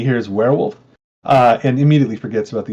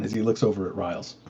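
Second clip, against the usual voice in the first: ordinary talk, nothing marked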